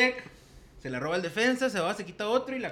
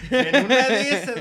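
laughing; 7 semitones higher, 8 dB louder, slower than usual. Real, not acted. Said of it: "Hasta me dio calor".

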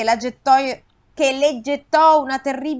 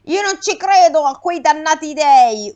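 Aiace shouting at the gods, louder; shouted defiance; 5 semitones higher, 6 dB louder than usual. A narrator's reading. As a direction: yell it skyward with a shaken fist. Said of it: "urlò Aiace". (Aiace urla contro gli dei)

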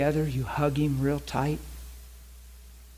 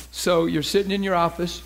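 normal talking voice

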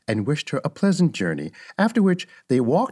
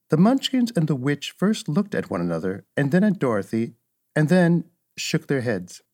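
ordinary delivery, nothing marked

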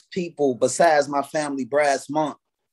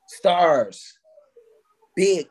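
normal talking voice

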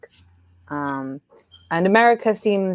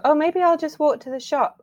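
higher, light inner voice; high musing voice; 10 semitones higher than usual. Retold or imitated. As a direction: say it up high, half to yourself. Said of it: "I still sometimes go".

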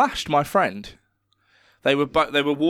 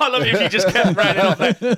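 higher and louder through laughter; laughing; 7 semitones higher, 5 dB louder than usual. Real, not acted. Amused at friend insulting the others, laughing. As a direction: laugh the words out.

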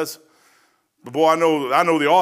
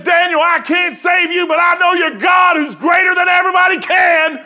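desperate shout; 13 semitones higher, 9 dB louder than usual. Acted. King calling to Daniel in the den, high desperate shout. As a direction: yell down into the pit.

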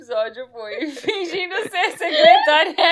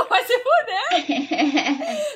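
laughing; 8 semitones higher, much slower than usual. Real, not acted. Joking insult while laughing.

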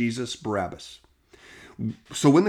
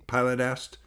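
ordinary delivery, nothing marked

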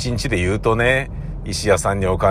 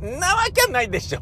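high-pitched, quick mock dismissal; comic 'oh, come on' scoff; disbelieving retort; faster than usual. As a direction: act out inner disbelief, voice shooting up. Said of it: "そういう反応だったね".

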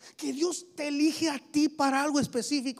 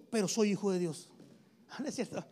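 usual speaking voice

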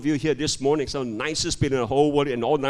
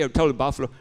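ordinary talk

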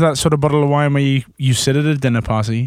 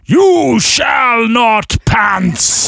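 very slow, booming wizard shout, higher; Gandalf; 8 semitones higher, 4 dB louder, much slower than usual. Acted. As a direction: bellow it like a wizard barring the way.

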